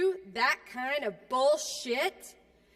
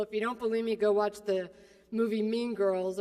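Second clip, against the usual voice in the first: ordinary talk, nothing marked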